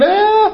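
sudden high yelp of frustration; stressed-out cry. Acted. Preacher acting out a stressed believer, high yelp. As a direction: let out a frantic high "ah" of stress.

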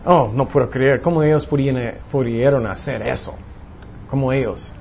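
low, mildly aggrieved disbelief; acted bitterness. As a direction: grumble as if quietly nursing a grudge. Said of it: "Tienes amargura en su corazón". (amargura fingida, en voz baja)

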